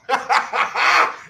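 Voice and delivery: loud laugh at the caller; burst of laughter. Real, not acted. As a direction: laugh out loud, caught off guard. Host laughs at banned caller.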